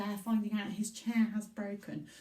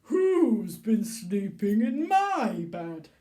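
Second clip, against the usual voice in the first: big loud slow bear voice; booming Daddy Bear demo; Daddy Bear for the class to copy; 9 dB louder, slower than usual. Shown as a demonstration. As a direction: boom it for the children to repeat. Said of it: "we can go".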